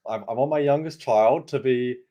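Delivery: normal talking voice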